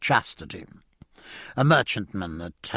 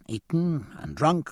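ordinary delivery

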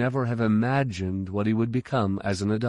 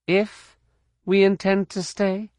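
put-on higher, incredulous young woman; indignant; 11 semitones higher, 4 dB louder than usual. A narrator's reading. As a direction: throw his words back at him. Jaina's indignant echo.

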